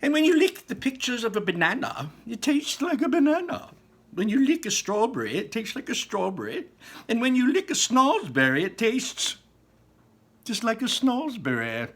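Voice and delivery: high, breathy, conspiratorial explanation; enthusiastic Wonka. A narrator's reading. Wonka explaining the lickable wallpaper, high and eager. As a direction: share a delicious secret, high and eager.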